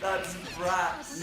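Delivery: hammed-up South London slang; mock South London slang. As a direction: bark it like a South London kid. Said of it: "the bollocks we used to come out with".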